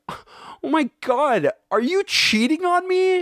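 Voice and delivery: high-pitched, drawn-out mock accusation; imitated shocked girlfriend. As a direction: play an appalled girlfriend catching him out. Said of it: "his girlfriend's like".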